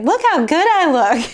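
high, loud mock preening with a laugh; hammed-up vanity; mock vain voice. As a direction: toss it off like admiring yourself in a mirror. Mocking mom's vain reaction.

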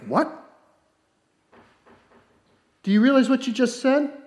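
like you can't believe what you just heard; incredulous. Acted. Jesus answering the doubting father, astonished. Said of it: "it's almost a guffaw".

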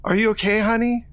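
calling through the locked door, high and sweet; sweet Rose calling. Acted.

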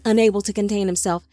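ordinary delivery

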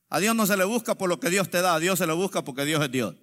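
ordinary delivery

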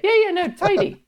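high-pitched Welsh auntie; mock a Welsh auntie's brisk verdict. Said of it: "Tidy." That's another one she does".

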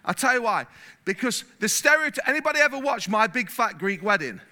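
ordinary talk, nothing marked

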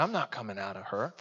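low, hushed demon refusal; deep demon voice. Acted. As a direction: drop way down and quiet like a demon. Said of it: "in a deep voice".

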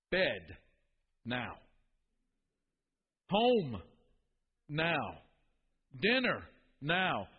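clipped parental commands, loud on key words; dad's curt commands. Retold or imitated. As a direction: bark each one-word order like a father losing patience. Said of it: "would jettison completely verbs from his vocabulary".

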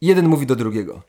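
usual speaking voice

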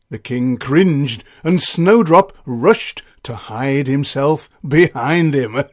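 ordinary delivery, nothing marked

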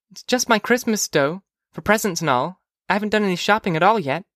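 high little-sister voice; young girl Phoebe. Acted. Phoebe to brother about her Christmas money, high girlish voice. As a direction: explain in a high child's voice, quickening at the end.

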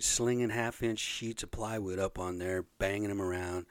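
ordinary talk

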